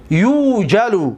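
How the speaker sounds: slow یوجلو, "yuujalu" with a long first vowel; passive-voice form. Shown as a demonstration. Teacher repeats for students, یوجلو pronounced slowly "yuujalu". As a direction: hold the "yuu" of یوجلو, then let it fall.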